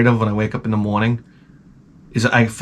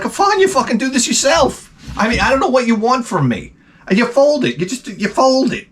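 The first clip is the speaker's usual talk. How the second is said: exasperated snap; 12 semitones higher than usual. Retold or imitated. Husband snapping at wife over folding clothes, exasperated shout. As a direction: throw up your hands and snap back.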